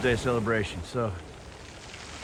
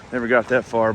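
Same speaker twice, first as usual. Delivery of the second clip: ordinary delivery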